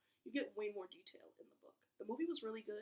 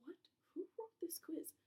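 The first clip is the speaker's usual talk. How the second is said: whispered disbelief; 8 semitones higher than usual. Real, not acted. Baffled by badly worded question.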